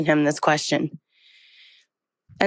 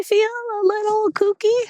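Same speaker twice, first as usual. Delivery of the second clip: kooky. Real, not acted. Walker confessing the belief made her feel odd, kooky. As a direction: voice sliding up high and held there.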